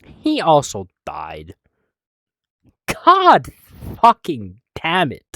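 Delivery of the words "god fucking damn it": loud, high burst of frustration; real frustrated outburst; exasperated cursing. Real, not acted. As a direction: curse loudly, starting high on the first word. John has died as well.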